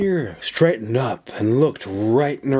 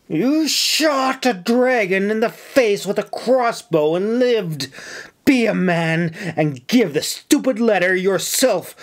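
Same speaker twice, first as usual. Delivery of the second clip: much higher, furious outburst; shaking with rage; 9 semitones higher than usual. Acted. Officer furiously berating a young soldier to be a man. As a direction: let the rage burst out at him. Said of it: "She was red and shaking with rage".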